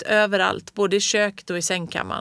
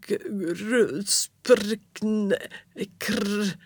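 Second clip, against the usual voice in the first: breathless choked gasping; panting gasps; 2 semitones higher, much slower than usual. A narrator's reading. Fritjof out of breath from smoke, panting gasps. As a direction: gasp out broken sounds, out of breath. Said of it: "flåsade han".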